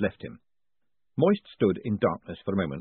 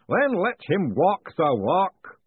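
slow, booming ritual master; 9 semitones higher, 5 dB louder, much slower than usual. A narrator's reading. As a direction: boom it out like a grand master of ceremonies. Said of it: "the unseen voice commanded".

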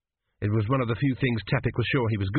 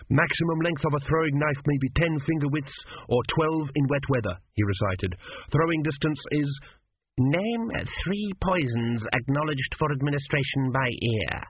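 higher, eager student voice; nervous student reciting; 4 semitones higher than usual. Acted. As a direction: rattle off the memorized answer. Student recites answers to examiner.

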